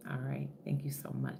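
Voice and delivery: soft unvoiced thank you; whispered thanks. Real, not acted. A quick whispered aside to viewers.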